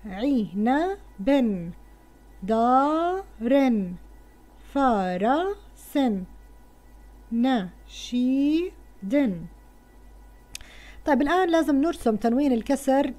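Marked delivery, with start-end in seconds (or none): 0.1-8.7 each word stretched out very slowly; very slow reading. Shown as a demonstration. Teacher reading the word list to pupils, very slowly stretched. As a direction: draw out every word so children can follow. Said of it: "نقرأ الكلمات".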